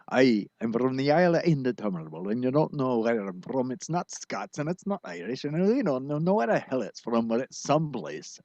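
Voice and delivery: put-on vague lilting accent, neither Scots nor Irish; indeterminable accent. Host playing the man with the indeterminable accent, lilting unplaceably. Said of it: "the man with the indeterminable accent".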